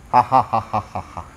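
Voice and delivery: imitating a possessed man's strange laughter. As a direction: cackle like a spirit sitting on a throne. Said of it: "Dia ketawa".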